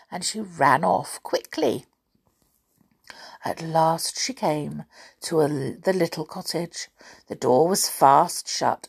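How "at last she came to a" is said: plain reading voice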